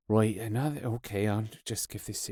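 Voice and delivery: low, soft imitation of resigned thoughts; weary inner grumble. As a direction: mumble it to yourself, imitating boredom. Musicians' weary thoughts during interviews.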